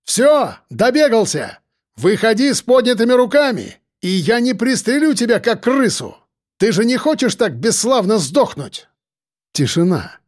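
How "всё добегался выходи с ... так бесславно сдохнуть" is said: shouting. A narrator's reading. Shouting threats to hidden maniac.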